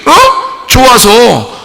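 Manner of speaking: high, loud, tearful preaching climax; fiery preaching. Acted. Preacher crying out to the congregation, high and loud. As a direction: cry out about the Lord's love at full force. Preacher rising to full force.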